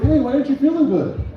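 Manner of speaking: high, eager puppy concern; eager dog voice. Retold or imitated. Comedian voicing a dog cheering up owner, high and eager. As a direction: bounce up like an excited dog. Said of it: "They're like".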